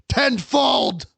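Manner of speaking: sudden loud, high dramatic yell; booming vengeful outburst; shouted threat. Acted. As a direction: explode on the last word of the threat. Climax of the villain's threat.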